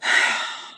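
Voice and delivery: long, real sigh of dismay; long breathy exhale of sorrow; heavy sigh. Real, not acted. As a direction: sigh out, lost for words. Lost for words over the loss.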